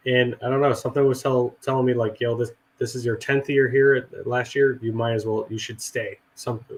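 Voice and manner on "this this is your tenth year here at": ordinary delivery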